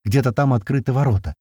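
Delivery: ordinary delivery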